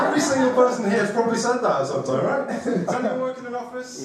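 chuckling through the words; laughing while talking. Real, not acted. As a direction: laugh as you speak. Comic laughing at own slip.

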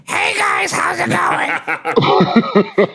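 very high, slow squeaky voice; high squeaky impression. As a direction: squeak it out like a cartoon. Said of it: "his voice sounds like this".

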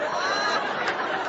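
airy silent laugh; wheezing, soundless giggle; real helpless laughter; breathless laugh. Real, not acted. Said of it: "I started laughing".

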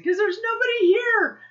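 high, loud whiny excuse; mock protest. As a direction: wail it as if it were obvious. Protesting to husband, acted.

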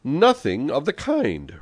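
high, drawn-out contradiction; slow, emphatic denial; sharp retort. A narrator's reading. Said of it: "retorted Don Camillo".

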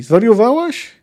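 high, loud incredulous question; shouted. A narrator's reading. Jaskier challenges the sorceress as she threatens, shouted. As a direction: cry it out in disbelief. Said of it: "krzyknął Jaskier".

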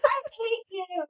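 a pretend sulky child's whimper; bratty stepkid. Stepmom mimicking a stepchild's tearful, hushed accusation. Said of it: "kids saying".

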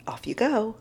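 mock British lilt on Off you go, falling on go; attempted British accent. Shown as a demonstration. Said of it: "I wish I could say it in the British accent".

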